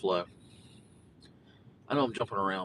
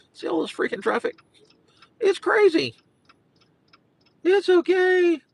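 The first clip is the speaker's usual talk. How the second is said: exclaiming; 7 dB louder than usual. Real, not acted. Reacting to traffic.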